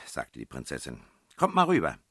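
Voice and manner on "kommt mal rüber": high, loud summons; calling over. A narrator's reading. Princess summoning friends across the street, calling over. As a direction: call them across to you.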